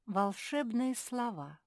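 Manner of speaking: plain reading voice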